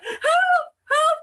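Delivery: high, loud mock cry of help; mum calling for help. Mum dangling from the attic, imitated high loud cry for help. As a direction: squeal for help like she's stuck. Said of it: "She's like".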